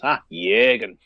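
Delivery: broad Liverpool Scouse voice; Scouse accent. Retold or imitated. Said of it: "in a proper Scouse accent".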